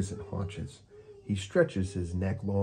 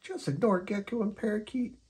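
high, soothing kitten; Kitty comforting Unicorn; 13 semitones higher than usual. Acted. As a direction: reassure a sad friend. Kitty character voice.

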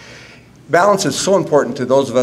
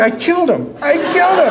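rising, ever higher panic; mock panic; 12 semitones higher than usual. Son fearing the news killed his father, rising comic panic.

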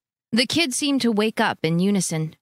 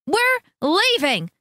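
much higher, slow, final; curt announcement; 11 semitones higher, slower than usual. A narrator's reading. Teresa to her sister, curt announcement. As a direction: announce it and shut the door on talk. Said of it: "Teresa announced".

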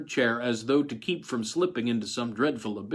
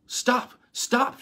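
high, louder frantic cry; 13 semitones higher, 6 dB louder, much slower than usual. A narrator's reading. Wife begs them to stop the injection, high frantic cry. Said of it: "she cried".

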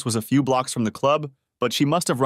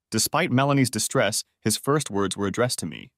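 plain reading voice

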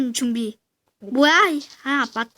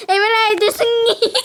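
triumphant cheer; 7 semitones higher than usual. Real, not acted. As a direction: cheer the win with a laugh. Victory cheer.

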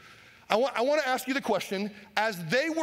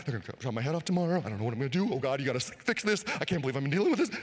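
lower, hurried fretting; lightly voiced panicked prisoner; 6 semitones lower, faster than usual. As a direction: mutter like someone pacing the night before execution. Imagined panicked prisoner pacing.

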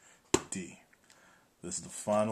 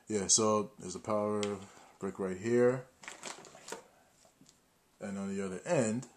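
ordinary talk, nothing marked

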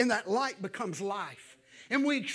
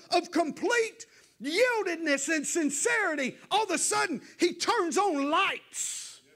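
full-force preaching; 5 semitones higher than usual. Acted. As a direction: drive it home from the pulpit.

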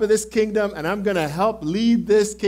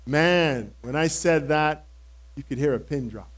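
ordinary delivery

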